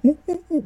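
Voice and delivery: very high, loud monkey "ooh, ooh"; loud falsetto ape calls; monkey hoots. Retold or imitated. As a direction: hoot like a jungle monkey. Imitating monkeys.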